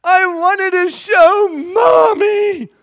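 put-on high, slow, loud sobbing little girl; wailing child. Father imitating his daughter wailing about her mom. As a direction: drag it out high in a sob.

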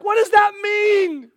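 high, loud, drawn-out cry; bewildered shout. Acted. Follower hearing Jesus's last words, shouting in bewilderment. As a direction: wail the question at full volume.

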